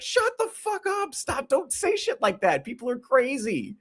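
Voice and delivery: very high, squeaky exasperation; high-pitched, incredulous pleading; exasperated pleading. Real, not acted. Pleading reply to online posts.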